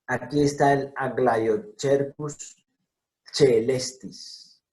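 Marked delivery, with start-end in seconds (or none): 3.3-4.6 celestis said slowly with ch sound, che-les-tis; pronounced che-lestis. Shown as a demonstration. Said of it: "C con E se pronuncia che".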